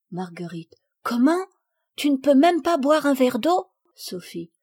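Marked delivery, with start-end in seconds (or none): 1.0-3.7 put-on high, loud, incredulous child; gasp-worthy disbelief, rising to the question; astonished question. Marguerite learns Sophie may not drink water, astonished.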